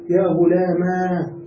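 غلاما ending in a long "aa"; ya turned to alif. Shown as a demonstration. Teacher showing third form, غلاما with drawn-out "maa". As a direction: slowly stretch the final alif of غلاما. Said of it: "بقلب الياء ألفا وبقلب الكسرة فتحة".

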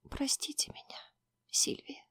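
whispered through tears; tearful whisper. Acted. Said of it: "прошептала сквозь слёзы".

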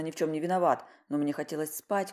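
ordinary delivery, nothing marked

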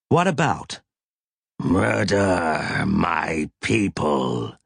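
low, very slow alien figure; grave creature, measured and heavy. A narrator's reading. Alien voice of Snowy.

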